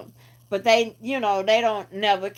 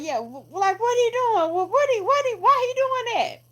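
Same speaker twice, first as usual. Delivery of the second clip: high, stammering confusion; baffled onlookers; 14 semitones higher than usual. Retold or imitated. Bystanders asking each other why he breaks windows, in a high baffled voice. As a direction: stumble over the words, baffled. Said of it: "they like".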